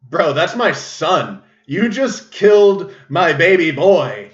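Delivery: hammed-up outrage at the hunter; mock outraged dwarf king. Dwarf king to the hunter who killed his son, mock outraged cry.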